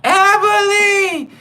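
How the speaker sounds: long, high, drawn-out falsetto call; flamboyant call. Retold or imitated. Inmate calls narrator across the yard, long high falsetto. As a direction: stretch the name out across the yard. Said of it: "eat 'em up comes running to the fence".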